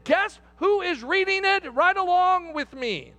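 high, driving preacher's delivery; impassioned preaching. Real, not acted. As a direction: preach it with rising fervor. Preaches at rising force.

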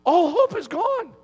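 a preacher's grieving repeat; anguished echo. Acted. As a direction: cry it out like a lament. Preacher echoes line in anguish.

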